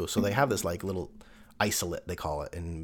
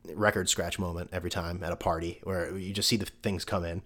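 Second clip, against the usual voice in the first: ordinary delivery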